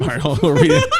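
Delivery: high, loud burst of laughter through words; real giggling; laughing. Real, not acted. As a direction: laugh out the line. Cracking up at co-host's joke.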